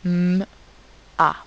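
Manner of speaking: M as a held loud hum, pause, then A; long closed-lip M without a vowel, then A; separate sounds. Shown as a demonstration. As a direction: split M and A into two isolated sounds. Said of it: "la dernière syllabe".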